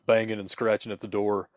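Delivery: ordinary talk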